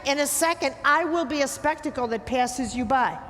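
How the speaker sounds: ordinary delivery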